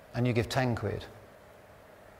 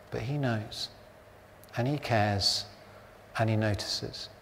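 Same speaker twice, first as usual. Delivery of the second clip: soft reassurance; 3 semitones lower, 7 dB quieter than usual. Real, not acted. Comforting congregation.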